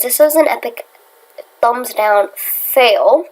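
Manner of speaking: ordinary delivery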